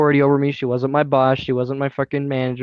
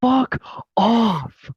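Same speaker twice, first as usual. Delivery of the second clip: high, drawn-out mock yell; re-enacted outburst, pitched up; retold yell; 9 semitones higher than usual. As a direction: yell it high but hold the volume back. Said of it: "yelled as loud as I could".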